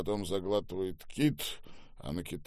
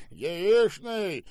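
high, jeering repetition; mocking echo; 12 semitones higher, 7 dB louder than usual. A narrator's reading. Boss echoes the manager's offer, mocking. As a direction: throw the word back scornfully. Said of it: "издевательски прищурился Штаубе".

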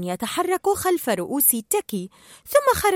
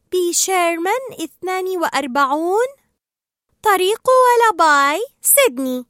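higher, sing-out recitation, slowing; reciting an address; 6 semitones higher, 5 dB louder than usual. Acted. Dory answers Marlin from memory, reciting. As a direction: recite it brightly, stretching the road name.

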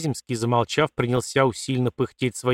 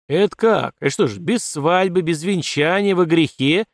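rising outrage, louder on the sin; outraged; 5 semitones higher, 4 dB louder than usual. Acted. Father flares up at the idea of sin, outraged. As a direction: get worked up in disbelief. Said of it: "Тут же завёлся полковник".

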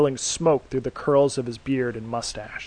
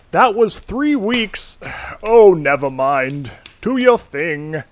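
role; 5 semitones higher, 8 dB louder, slower than usual. Acted. Weary Santa humours the superhero.

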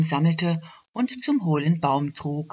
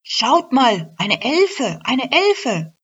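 higher, louder amazed cry; excited calling; 8 semitones higher, 8 dB louder, slower than usual. Acted. Bank clerk calls colleagues to see the elf, excited shout. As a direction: call your colleagues over in wonder. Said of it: "rief zu ihren Kolleginnen".

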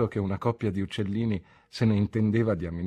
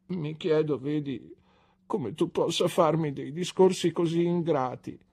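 tearful complaint; 6 semitones higher than usual. A narrator's reading. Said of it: "pianse Dora".

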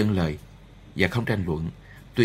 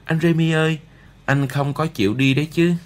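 put-on higher, louder boy's cry; 7 semitones higher, 3 dB louder than usual. A narrator's reading. Upset Arthur calls to Remy not to leave, crying out higher. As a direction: call out plaintively in a higher voice. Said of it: "nó kêu to".

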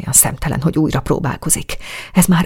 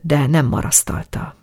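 ordinary delivery, nothing marked

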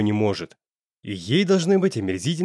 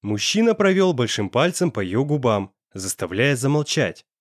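usual speaking voice

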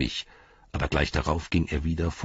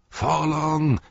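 higher, louder call of Forlong; crowd calling; 11 semitones higher, 4 dB louder than usual. A narrator's reading. Crowd calls out Forlong's name, louder. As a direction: hail him from the crowd. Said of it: "riefen die Menschen".

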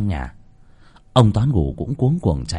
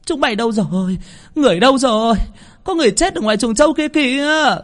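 screaming for help; 14 semitones higher, 3 dB louder, faster than usual. Acted. Mistress running into the yard, screaming for everyone. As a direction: shriek out to rouse the whole house. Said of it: "thì hét toáng lên".

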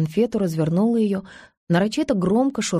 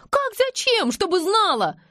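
very high, shrieky exclamation; indignant retort; 13 semitones higher than usual. A narrator's reading. Anush insists on telling Valya, high and indignant. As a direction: answer as if it were obvious.

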